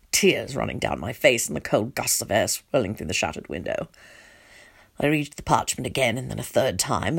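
low, soft, numb telling; subdued story narration. A narrator's reading. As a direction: narrate it hushed and low, as if in shock. Parent narrates relief after message.

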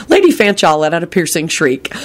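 ordinary delivery

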